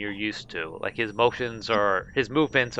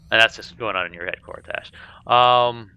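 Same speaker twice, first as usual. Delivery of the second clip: ordinary talk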